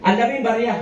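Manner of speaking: ordinary talk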